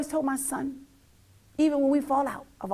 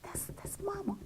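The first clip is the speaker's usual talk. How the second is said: whisper; 7 semitones higher, 15 dB quieter than usual. Real, not acted. Proud quiet aside.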